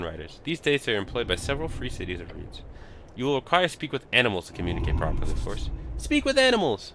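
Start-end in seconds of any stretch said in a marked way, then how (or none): 6.0-6.9 excited. Acted. Reading the spell name aloud.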